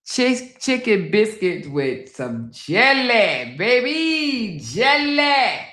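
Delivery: high, drawn-out swoops on jelly; stretched, delighted food craving; gushing food hype. Real, not acted. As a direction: sing out the last words with relish. Gushing over food on the menu.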